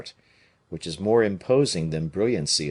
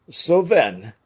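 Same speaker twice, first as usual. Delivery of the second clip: higher, louder musketeer; pressing question; Porthos voice; 8 semitones higher, 6 dB louder than usual. A narrator's reading.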